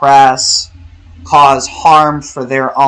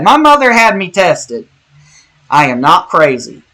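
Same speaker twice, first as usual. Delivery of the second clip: put-on Texan accent, pitched up and louder; Texan accent Sheldon; 5 semitones higher, 4 dB louder than usual. Quoting a TV line to viewers in a Texan accent. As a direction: quote it playfully in a Texan accent. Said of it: "I did it in the Texan accent".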